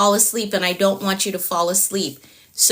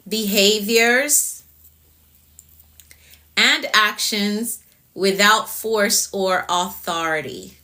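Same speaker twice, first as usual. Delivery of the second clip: slow, spaced out, each item weighed; slow and deliberate; slower than usual. Shown as a demonstration. Teacher to live viewers, slow and deliberate. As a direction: lay the definition out slowly so it sinks in. Definition repeated slowly for emphasis.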